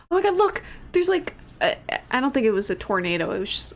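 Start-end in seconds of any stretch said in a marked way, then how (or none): 0.1-1.4 high, alarmed pointing-out; mock alarm. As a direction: imitate someone spotting a tornado. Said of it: "someone's like".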